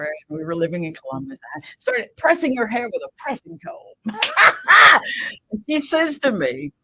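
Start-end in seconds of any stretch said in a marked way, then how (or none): 4.2-5.5 a burst of loud high laughter. Real, not acted. Laughing while pressing aunt's hair.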